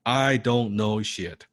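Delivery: slow, deliberate repetition for the group; slow mantra. Shown as a demonstration. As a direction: drag each word out for them to echo. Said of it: "Say it with me".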